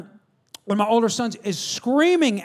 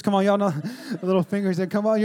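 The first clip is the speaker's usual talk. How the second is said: ordinary talk, nothing marked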